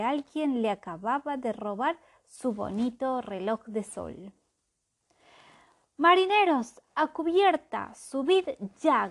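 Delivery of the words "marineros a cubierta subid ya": loud, high captain's call to the crew; sudden shout for all hands; shouted order. Acted. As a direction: bellow it across the deck. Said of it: "gritó".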